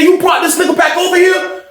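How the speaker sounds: high-pitched imitation of an indignant inmate; retold outraged question. Same outraged question to officer.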